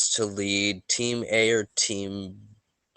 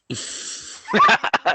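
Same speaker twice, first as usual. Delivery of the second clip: a sudden high, loud laugh he can't hold in; burst of laughter; 6 dB louder than usual. Real, not acted. Host laughing at his own shower-written intro, high loud burst. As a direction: crack up before the intro even starts. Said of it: "I cannot do it without laughing".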